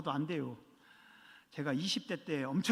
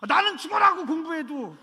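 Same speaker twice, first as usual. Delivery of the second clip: high, loud put-on whine of a jealous kid; a pastor imitating a sulky believer's whine; whiny mock complaint; 10 semitones higher, 10 dB louder than usual. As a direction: whine it like a sulky student who studies hard for nothing. Mimicking a jealous student.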